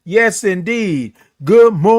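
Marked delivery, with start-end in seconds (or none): 1.4-2.0 loud, high sung-out greeting; drawn-out morning greeting. Real, not acted. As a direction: hold the O of morning very long. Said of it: "the way that I say it".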